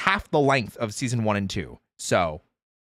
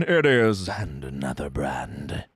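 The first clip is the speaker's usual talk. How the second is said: dramatic low intro; 10 semitones lower, much slower than usual. Acted.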